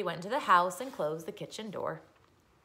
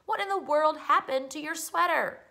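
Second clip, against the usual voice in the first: alarmed mother; 9 semitones higher, 5 dB louder than usual. A narrator's reading. Mother alarmed at torn sweater.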